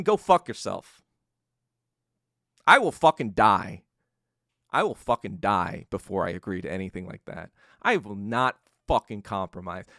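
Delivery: firm, loud refusal to an enemy president; defiant outburst. Retold or imitated. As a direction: spit it at him like a dying leader who won't bow. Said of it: "he says to Nixon".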